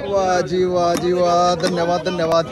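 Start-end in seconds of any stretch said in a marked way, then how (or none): none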